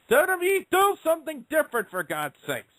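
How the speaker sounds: fed-up cry that trails off; exasperated plea. Real, not acted. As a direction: beg the company, fed up. Pleading with the company.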